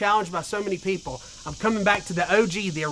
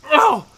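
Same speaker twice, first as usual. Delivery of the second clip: cold shocked gasp; 8 semitones higher, 8 dB louder than usual. Real, not acted. Reeling from ice water cold.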